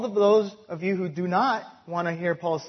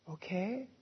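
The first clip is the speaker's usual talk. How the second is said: normal talking voice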